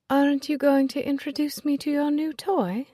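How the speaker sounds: high, sweetly venomous jab; catty rival. Acted. Lucy mocking Nicholas's new date, sweetly catty. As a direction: purr the insult.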